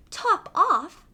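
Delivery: mouse cries out the name high; a startled little squeak of a question; surprised cry. Acted. Mouse hearing the odd name, surprised cry. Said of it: "cried the mouse".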